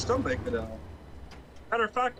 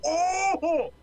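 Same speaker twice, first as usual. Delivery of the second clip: delighted oh and laugh; 12 semitones higher than usual. Real, not acted. Reacting to chat joke.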